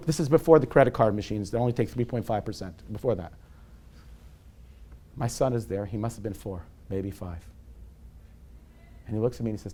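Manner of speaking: usual speaking voice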